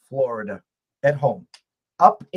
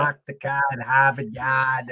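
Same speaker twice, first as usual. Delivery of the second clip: put-on Boston accent. Guest shows his Boston accent to the host, lightly. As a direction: toss off the classic Boston phrase. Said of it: "it's just like the Boston accent".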